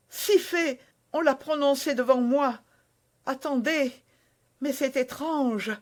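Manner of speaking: lightly voiced young wife, earnest; young woman's voice. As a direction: naive Constance, a touch eager. Constance voiced by narrator.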